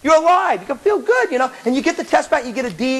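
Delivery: ordinary delivery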